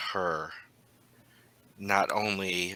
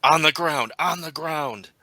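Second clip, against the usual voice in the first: raised, higher-pitched agents' yell; 7 semitones higher, 7 dB louder than usual. Acted. Game master voices armed agents surrounding player, yelling. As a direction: shout it as an urgent order. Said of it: "they are yelling".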